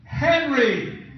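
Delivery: high calling out for Henry; mock yell. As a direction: call across the room, imitating her. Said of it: "she yells out".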